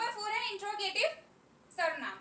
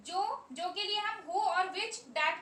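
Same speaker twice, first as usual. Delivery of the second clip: slow dictation pace; much slower than usual. Shown as a demonstration. Teacher spelling out the rule slowly.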